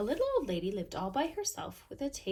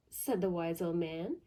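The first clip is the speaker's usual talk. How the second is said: usual speaking voice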